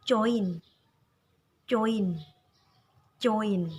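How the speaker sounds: "join" with a loud, clear oi. Shown as a demonstration. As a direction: stress the oi of "join". (ucapkan "join" dengan bunyi oi yang jelas)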